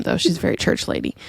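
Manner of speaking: ordinary talk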